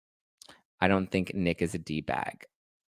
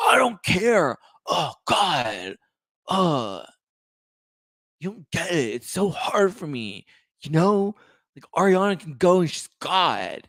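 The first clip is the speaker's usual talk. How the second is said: high, breathy, whiny Sandoval voice; Sandoval impression; 9 semitones higher, 4 dB louder, slower than usual. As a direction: do your breathy Sandoval, sulky and self-pitying. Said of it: "as breathy as my version of Sandoval".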